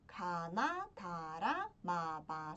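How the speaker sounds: low "Kana, tara" with long rising sweeps; sing-song run down the consonant list; syllable drill. Shown as a demonstration. Teacher counting down to the consonant cha, syllable drill.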